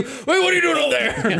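high, startled call up to the roof; a guard startled by someone on the roof; Bob calling up. Acted. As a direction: call up in surprise. DM voices Bob noticing Awen.

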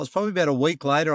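ordinary talk, nothing marked

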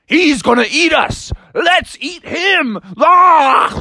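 high shouted monster voices; rally the monsters with a high yell, ending in a roar; hammed-up attacking pumpkins; menacing pumpkins. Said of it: "And they're like".

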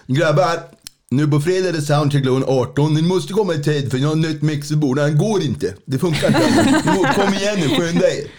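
imitating Mange's Örebro dialect, half laughing. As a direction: sound like a bandleader from Örebro calling the guys to soundcheck. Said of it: "Han pratar ju så här".

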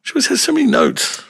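squeaky imitation of a guest; high mock voice. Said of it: "they're like".

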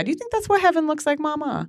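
put-on high, fast, innocent kid voice; little boy. Mom imitating her little son asking about heaven, high and quick. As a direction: ask like a wide-eyed little boy.

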